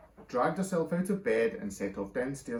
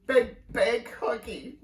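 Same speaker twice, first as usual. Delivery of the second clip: put-on slow, high tearful toddler; weeping baby; 10 semitones higher, 5 dB louder, much slower than usual. A narrator's reading. Baby carried off by brother, weeping for a hug. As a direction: sob it out as a baby. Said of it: "wept the baby".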